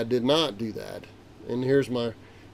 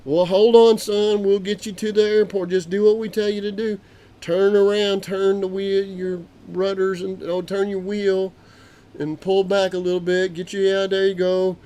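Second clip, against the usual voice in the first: tower instructions; 7 semitones higher, 5 dB louder than usual. Retold or imitated. Tower guy instructs over radio.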